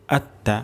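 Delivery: আত্তা said with no stretch; short, quick vowel. Shown as a demonstration. Teacher repeats it to be read quickly, short vowel. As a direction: keep both vowels of আত্তা brief. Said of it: "দ্রুত পড়ব".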